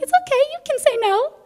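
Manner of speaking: hint of a calming husband; husband reassuring. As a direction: lightly mimic a gentle husband. Husband calming her, voiced.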